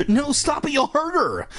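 put-on very high panicked little-girl voice; high frantic cry; gasping alarm. A narrator's reading. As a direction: plead in a squeaky, hammed-up fright. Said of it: "Ashley gasped".

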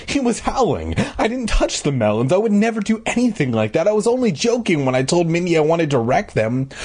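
high-pitched, hurried self-defence; insisting. A narrator's reading. Joe denying he touched the melons, insisting. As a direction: protest innocence, voice kept high. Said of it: "I insisted".

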